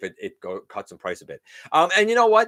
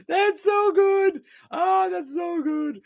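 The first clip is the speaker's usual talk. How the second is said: much higher, slow, gushing praise; delighted squeal; much slower than usual. Real, not acted. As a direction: squeal it out, thrilled by the joke. Delighted by the Orko idea.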